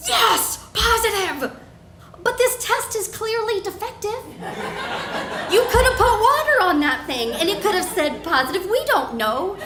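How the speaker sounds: high, loud mock celebration; sarcastic elation. Woman mocking her own positive test, sarcastically thrilled. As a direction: cheer it, then sneer that the test is defective.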